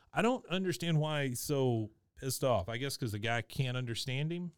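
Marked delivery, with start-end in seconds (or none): none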